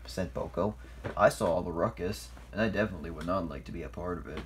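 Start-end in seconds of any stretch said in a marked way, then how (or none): 1.2-4.4 low, quick, shy voice; lightly voiced shy diesel. A narrator's reading. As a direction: mumble it fast, shy. Boko voiced, hiding.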